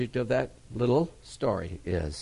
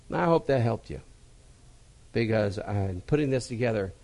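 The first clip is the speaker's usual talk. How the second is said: usual speaking voice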